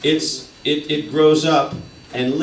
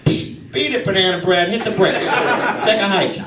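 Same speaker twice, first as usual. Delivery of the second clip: put-on Boston accent; 9 semitones higher than usual. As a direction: bark silly insults in a thick Boston accent. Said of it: "they got the crazy accent".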